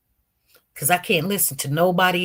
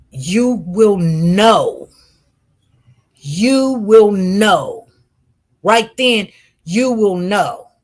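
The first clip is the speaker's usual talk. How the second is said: mock soft voice; 5 dB louder, much slower than usual. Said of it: "This voice is telling me in a soft voice".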